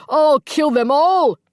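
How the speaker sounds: very high, loud, slow enraged roar; dragging out a raging war cry; furious roar. A narrator's reading. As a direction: berserk vow of vengeance. Archie charging enemy soldiers.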